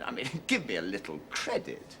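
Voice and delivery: ordinary talk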